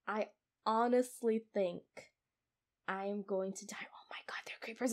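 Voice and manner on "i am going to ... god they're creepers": breathy scared whisper; frightened whisper. Real, not acted. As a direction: hush in fear. Scared in the dark.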